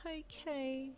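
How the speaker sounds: resigned sing-song. Acted. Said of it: "you sung with resignation".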